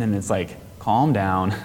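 usual speaking voice

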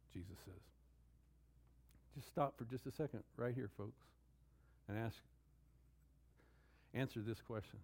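ordinary talk, nothing marked

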